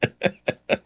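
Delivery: quiet, breathy chuckle; short stifled laugh under the breath. Real, not acted. Host amused at his own serious question, quiet laugh. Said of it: "but I'm laughing".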